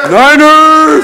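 high, loud drawn-out fan shout; rabid fan yell. Acted. Plays rabid football fan yelling.

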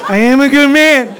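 high, loud emotional wail; wailing affirmation. Acted. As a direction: cry it out with full hammed-up emotion. Same words, now an emotional outburst.

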